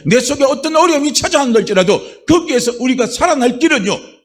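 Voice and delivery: high, loud fervent sermon voice; a pastor building to a loud, fervent climax; full-force preaching. Acted. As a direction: drive it home to the congregation.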